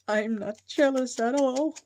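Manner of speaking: mock denial of envy; sarcastic. Teasing about someone's air fryer dinner.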